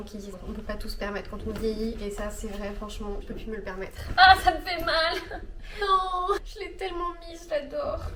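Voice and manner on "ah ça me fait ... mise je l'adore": laughing mock anguish. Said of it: "Ça me crève le cœur".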